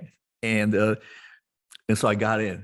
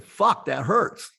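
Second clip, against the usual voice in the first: higher, louder, drawn-out cry of pain; loud pained outburst; 8 semitones higher, 5 dB louder, much slower than usual. Retold or imitated. Patient jabbed with the lidocaine needle, loud pained outburst. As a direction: curse out loud at a sudden jab. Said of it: "I said, like, that loud".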